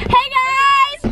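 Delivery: bright, loud vlogger hello; vlogger greeting. Acted. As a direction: greet the viewers with energy. Vlog intro to camera.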